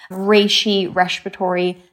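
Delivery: "respiratory" lisped as RESH-piratory after "Reishi"; slight lisp. Shown as a demonstration. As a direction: link "Reishi, respiratory" with a playful SH. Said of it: "again, with a little lisp".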